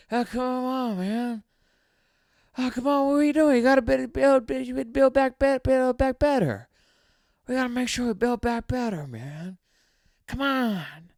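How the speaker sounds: a deliberately bad, exaggerated Biden; Joe Biden impression. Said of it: "That's a terrible Biden".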